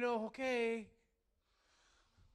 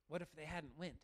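ordinary talk, nothing marked

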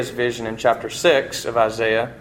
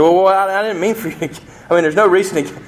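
higher, stammering mock alarm; flustered panic; 7 semitones higher, much faster than usual. The ghost stammers a mild mock panic at the angel's offer to kill the lizard. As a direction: backpedal in a hurry, half-hearted fright. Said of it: "He said".

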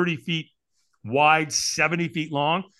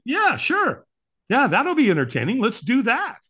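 high, loud, eager yes; hammed-up delight at a new idea; gleeful agreement; 9 semitones higher, 5 dB louder than usual. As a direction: jump at the offer, voice pitched up. Acts out sudden eagerness to watch.

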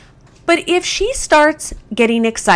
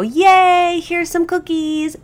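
much higher, bright puppy-party cheer; really fun voice; 13 semitones higher, slower than usual. Shown as a demonstration. Trainer hands out kibble to the dog, high excited cheer. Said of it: "I say it in a really fun voice".

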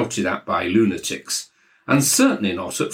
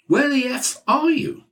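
much higher furious demand; Maxwell shouting. Acted. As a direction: bellow it down a phone line. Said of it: "Maxwell was shouting down the line".

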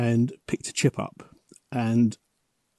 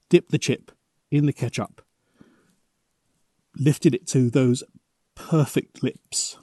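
usual speaking voice

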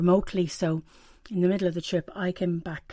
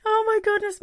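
tearful exclamation; 13 semitones higher than usual. Retold or imitated. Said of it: "every so often I'd go".

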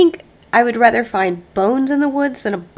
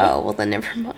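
much lower, disappointed mumble; deflated; 6 dB quieter than usual. Retold or imitated. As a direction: let the excitement drop. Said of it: "I'm like".

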